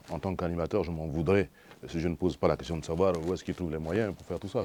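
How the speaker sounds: usual speaking voice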